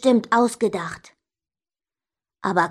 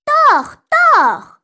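loud, very high first word then dropping; shouted retort; 8 dB louder than usual. A narrator's reading. Sister contradicts brother in quarrel, loud insistent shouts. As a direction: shout back stubbornly.

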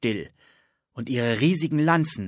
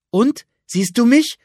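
much higher, louder excited asking; bursting to know if it worked; girl in the cloak asking her brother, high and thrilled; eager question; 13 semitones higher, 11 dB louder than usual. Acted. Anne tests invisibility, excited.

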